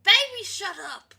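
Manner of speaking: sudden loud, high retort; playful shout. Pretend. Player tells an objecting companion to hush, shouting. Snapping back at game companion.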